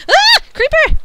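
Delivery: startled shriek, very high and loud; panicked scream. Real, not acted. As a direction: scream in real fright. Creeper appears suddenly.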